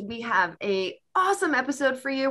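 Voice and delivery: ordinary talk, nothing marked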